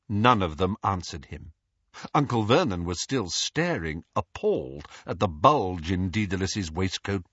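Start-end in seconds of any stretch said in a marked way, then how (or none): none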